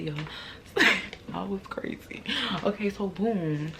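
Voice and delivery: usual speaking voice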